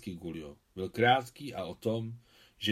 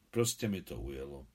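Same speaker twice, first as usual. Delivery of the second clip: lower, softer sheepish excuse; embarrassed; 7 semitones lower, 5 dB quieter than usual. Acted. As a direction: own up awkwardly, voice dropped. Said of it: "rozpačitě se zachmuřil Adam".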